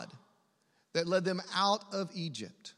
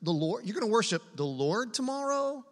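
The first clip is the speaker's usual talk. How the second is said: disbelieving, rising to the end; incredulous question. Acted. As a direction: question him as if you can't believe it.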